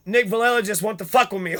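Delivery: ordinary talk, nothing marked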